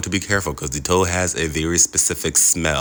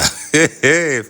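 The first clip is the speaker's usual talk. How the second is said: high laugh; 11 semitones higher, 5 dB louder than usual. Real, not acted. Laughing at his own joke.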